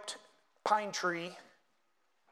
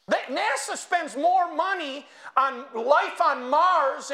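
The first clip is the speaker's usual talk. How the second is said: fiery preaching; 6 semitones higher, 4 dB louder than usual. Acted. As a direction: preach it hard to the congregation. Preaching at full force.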